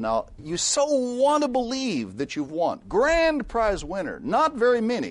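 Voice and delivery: high, slow, syrupy salesman voice; mock salesman; mock sales pitch. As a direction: ham up the smooth-talking scammer, drawn out.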